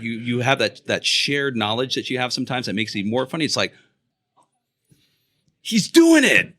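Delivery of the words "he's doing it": high, loud burst of glee; delighted recognition. Retold or imitated. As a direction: re-enact a thrilled fan spotting a favorite bit. Retelling own delighted reaction.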